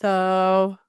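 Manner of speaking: low, sluggish mock mumbly opener; Eve. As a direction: sink down lazily on the word. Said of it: "you drop it even more".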